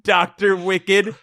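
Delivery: slow, drawn-out doom voice; slow dramatic narration. Acted. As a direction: stretch it out with mock gravity.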